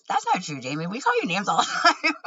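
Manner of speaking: retelling his joke, laughing through it fast; laughing through quote. Retold or imitated. Quoting the guy's teasing burn, laughing. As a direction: rush the line, cracking up at the end. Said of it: "because I was laughing".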